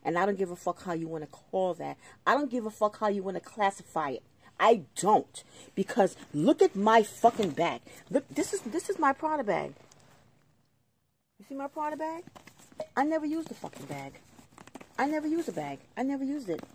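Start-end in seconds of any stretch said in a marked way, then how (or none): 2.2-13.5 loud, rising real exasperation; angry outburst. Real, not acted. Resident showing the housing authority a ruined Prada bag, angry. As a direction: protest loudly, pitch climbing with anger.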